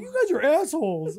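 wife scolding. Retold or imitated. The wife calling them out at dinner, high and indignant. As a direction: call them assholes, half laughing. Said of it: "She's like".

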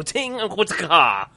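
high, loud vocal sword clinks; imitated sword sounds. Player mimes cutting the rope with a sword, imitated clinking. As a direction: voice the blade ringing as it strikes. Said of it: "I wanna go".

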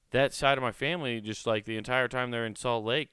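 ordinary talk

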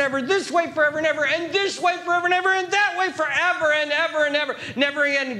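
excited, escalating preacher's cascade; breathless build-up. Real, not acted. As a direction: tumble through the repetitions without stopping. Piles up infinity in excitement.

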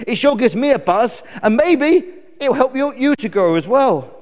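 high, loud bluebottle voice; perky buzzy fly; helpful fly. Acted. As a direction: encourage as an eager little insect.

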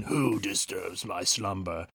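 slow, hushed stone-guardian voice; a soft, ominous statue voice; grinding stone statue. Pretend. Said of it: "I wanna do a supercut of saying".

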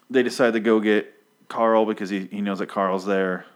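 normal talking voice

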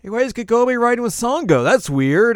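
high, loud puzzled question; puzzled Inuyasha. Retold or imitated. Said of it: "Inuyasha's like".

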